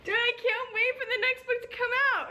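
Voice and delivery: loud, high, almost crying; tearful excited cry. Real, not acted. Desperate for the next book, a high tearful shout. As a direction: shout your impatience, voice breaking. Eager for the sequel.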